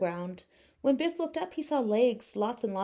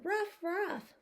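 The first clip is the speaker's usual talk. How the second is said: high yappy ruff ruff; little dog barks; 9 semitones higher, 3 dB quieter than usual. Acted. Pocket dogs greeting their owner with high barks. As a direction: bark it like tiny dogs. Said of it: "Biff and Buff always said".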